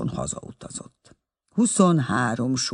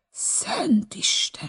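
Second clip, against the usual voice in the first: slow, shocked whisper; a stunned whisper at the photo; 8 semitones higher, 4 dB quieter, much slower than usual. A narrator's reading. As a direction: breathe it out in horror.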